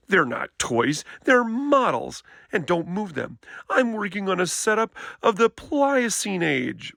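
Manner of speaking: put-on a touch of an indignant young bear; protesting little brother. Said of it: "Brother protested".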